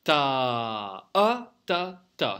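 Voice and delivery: long "Ta" punched again on "a", then short "ta, ta"; stress on the dot. Shown as a demonstration. Teacher insists on the dot, accented rhythm demo. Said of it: "On insiste bien sur le point".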